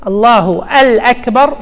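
"الله الأكبر" drawn out slowly, the al- of الأكبر sounded separately; added definite article. Shown as a demonstration. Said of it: "ألف ولام تعريف".

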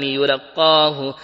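يلقاه drawn out as "yu-laqqaahu", u on the ya; doubled qaf. Shown as a demonstration. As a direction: read يلقاه slowly with a held double q. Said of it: "وتشديد القاف".

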